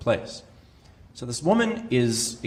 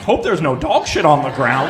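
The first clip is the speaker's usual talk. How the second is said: mock worried traveller; 7 dB louder than usual. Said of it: "being like".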